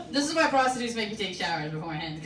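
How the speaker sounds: normal talking voice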